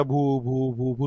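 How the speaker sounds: low, soft fake boo-hoo; mock crying. Scolded production manager starts to cry, mock sobbing. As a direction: imitate sobbing in low, quiet syllables. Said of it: "production manager रोने लगे".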